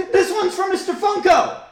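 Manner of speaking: high, loud hammed-up holler; over-the-top showman's cry; mock dedication shout. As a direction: yell it to the back of the crowd. Topping the other's mock shout.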